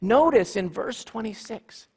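normal talking voice

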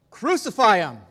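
a loud roaring crucify him; mob roar. Acted. The mob roaring at Pilate, loud shout. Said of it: "the mob roared even louder".

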